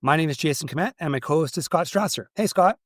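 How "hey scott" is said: usual speaking voice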